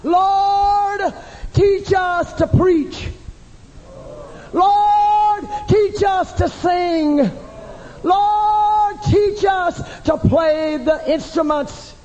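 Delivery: high, long-held "Lord", then drawn-out plea; crying out. Acted. Preacher voices the disciples begging to be taught, crying out. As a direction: cry it out to heaven, stretching the words. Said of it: "No wonder they cried out".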